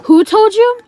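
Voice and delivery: high, incredulous question, acted out; mock-indignant high challenge; demanding question. Retold challenge to the cousin.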